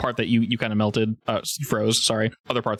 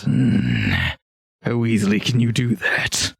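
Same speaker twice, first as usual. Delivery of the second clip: scheming ogre; slower than usual. Acted. Ogre chief licks his teeth and asks the caster, scheming. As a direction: savour it like a hungry ogre. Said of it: "and goes".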